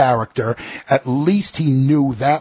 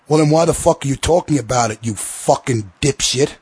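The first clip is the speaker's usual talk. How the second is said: usual speaking voice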